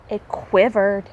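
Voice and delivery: usual speaking voice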